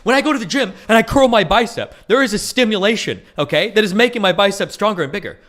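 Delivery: over-explain it, exasperated; exasperated insisting. Retold or imitated. Streamer replaying himself arguing obvious point, loud exasperated. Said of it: "I'm like".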